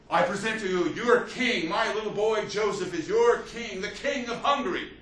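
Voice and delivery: put-on high, loud, ringing proclamation presenting her baby king; Maria Theresa proclaiming. Young queen presents her son to Hungarian nobles, high ringing proclamation. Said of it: "and she said".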